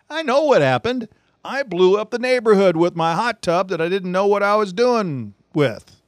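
high-pitched comic blurting; mock confession. Mock confession after feigned ignorance.

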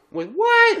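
high disbelieving with what; shocked. Retold or imitated. Friend hearing about the teacher, high shocked question. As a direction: jump way up in disbelief.

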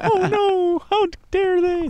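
shrieking high, hammed-up prim lady; mock scandalised lady. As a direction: clutch your pearls in a squeaky falsetto.

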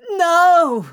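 loud, high, desperate cry; scream. A narrator's reading. Crowley lunging at the archangel, desperate scream. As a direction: scream it to stop him. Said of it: "Crowley screamed".

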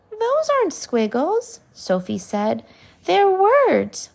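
Sophie exclaims. Acted. Sophie corrects the giant.

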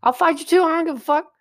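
high, cracking voice. Real, not acted. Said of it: "Why your voice crack?".

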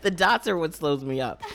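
laughing while talking; giggling through the sentence. Real, not acted. Joking about the name, laugh.

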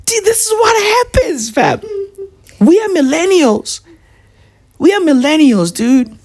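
hammed-up wail over being millennials; mock crying. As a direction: fake crying about his generation. Mock lament about millennials.

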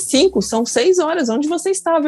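scolding nurse. Retold or imitated. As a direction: play the nurse who has been waiting since five.

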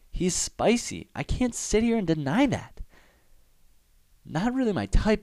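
high, gushing fangirl voice; mock swooning. Admitting [name] is spicy, high and gushing. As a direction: gush it up high like a fan.

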